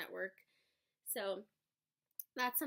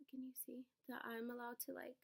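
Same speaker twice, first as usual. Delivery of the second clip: whisper; 3 semitones higher, 6 dB quieter than usual. Real, not acted. Quiet aside to camera.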